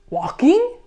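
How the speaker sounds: high, rising guess; questioning guess. Acted. Beaver answers Bird's hint, questioning rise. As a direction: offer it as a curious guess. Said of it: "guessed Beaver".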